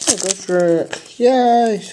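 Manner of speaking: high, bright cheer at a good pull; excited squeal. Real, not acted. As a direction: squeal with delight. Excited pull during pack opening.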